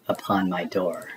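ordinary delivery

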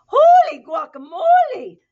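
very high, loud startled cry; surprised exclamation. Acted. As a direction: gasp it out as if the mirror shocked you. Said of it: "exclaimed Skippy John Jones".

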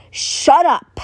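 angry. Acted. Bakugo snaps at friends.

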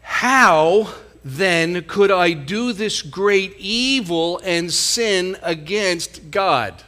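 each word slow and weighty; slow, weighty question. Shown as a demonstration. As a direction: let the question sink in slowly. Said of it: "Now watch what he says".

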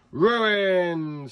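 high, stretched-out screech of triumph; one long, high gleeful cry; villain's screech. A narrator's reading. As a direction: screech it like a gloating cartoon villain. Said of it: "screeched the pea".

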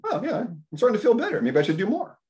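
suddenly energised self-talk; perking up. Pretend. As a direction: sound surprised that you feel better. Voicing yourself perking up.

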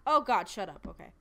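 ordinary talk, nothing marked

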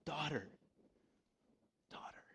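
softly, fading almost to a whisper; tender. Acted. Jesus calling the healed woman, soft and fading. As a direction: lovingly call her daughter, trailing off. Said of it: "I just love Jesus' response".

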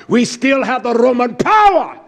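loud, high shout; pulpit shout. Acted. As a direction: thunder it from the pulpit. Preacher builds to a shout.

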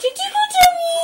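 high laughing shout; excited playful shout. Real, not acted. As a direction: burst out laughing as you pounce. Tickle game climax.

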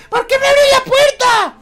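high indignant falsetto; woman voice. Pretend. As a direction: protest in a high lady voice. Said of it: "sé hacer voz de una perfecta mujer".

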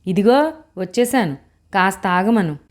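ordinary delivery

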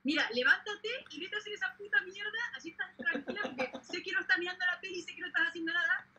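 put-on exasperated girlfriend urging her up; mock girlfriend telling her to go work; imitating girlfriend. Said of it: "y me dice".

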